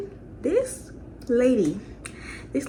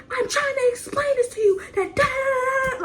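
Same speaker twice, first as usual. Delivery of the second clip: high, crying shout at mother; yelling at her; 13 semitones higher than usual. Retold or imitated. Yelling at her mother over the makeup vanity, crying shout. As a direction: yell it through tears, voice cracking high. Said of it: "like, I'm just yelling".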